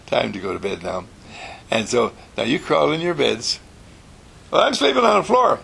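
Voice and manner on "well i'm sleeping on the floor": put-on high, loud child voice; cheeky child. Little boy announces he'll sleep on the floor, high and loud.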